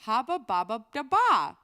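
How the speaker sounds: loud nonsense dog chant; Dog of Wisdom. Retold or imitated. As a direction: belt out the talking dog's babble. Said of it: "that is the Dog of Wisdom video".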